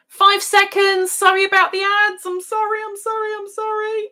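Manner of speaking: high-pitched, loud apologising; high, repeated sorry as time runs out; urgent apology. Real, not acted. Hurried apology as ads cut in.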